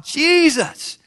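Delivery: high, drawn-out imitated yell; crying out. Blind men crying out to Jesus passing by, high drawn-out yell. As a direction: call out as if across a crowd. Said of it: "they're crying out".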